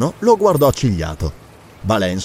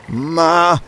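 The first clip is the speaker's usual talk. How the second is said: puzzled, drawn-out syllable; falter, unsure what to say; bewildered little protest; perplexed hesitation; 4 semitones higher than usual. A narrator's reading. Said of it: "fece il conte perplesso".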